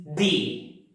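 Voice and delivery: "the" with an ee vowel, like "dee"; "the" as thee. Shown as a demonstration. Teacher asks when to use it, "the" as thee. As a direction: say "the" to rhyme with see. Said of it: "at which situation do we have to use".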